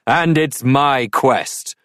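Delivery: slow, pointed knightly retort. A narrator's reading. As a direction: fire it back, slowly and smugly. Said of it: "retorted the knight".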